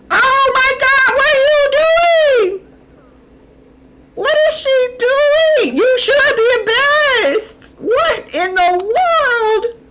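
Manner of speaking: high, loud, slow scandalized cries; high outraged shouting at the screen; shocked shriek. Real, not acted. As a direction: shriek in horrified disbelief. Shocked at sim's embarrassing act.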